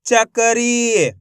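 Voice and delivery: "चकरी" very slowly in one joined word; slow teaching read of "चकरी", syllables linked; blended, drawn-out word. Shown as a demonstration. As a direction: stretch "चकरी", holding the final ee. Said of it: "तो मिलके बन जाएगा".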